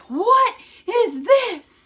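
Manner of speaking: high, loud alarmed yell; retold yell. Husband finds a stretch mark in the shower, yelling. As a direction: shout it in shock, like finding something strange. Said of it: "all of a sudden he starts yelling".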